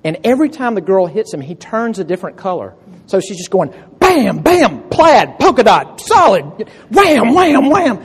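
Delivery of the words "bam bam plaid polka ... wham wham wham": loud, high cartoon bam and wham; excited sound effects. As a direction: punch out each hit word. Retelling girl beating Randall.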